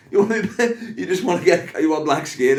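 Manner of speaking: high, loud, laughing incredulity; laughing teasing. Retold or imitated. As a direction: crack up while teasing the question out. Teasing audience member, cracking up.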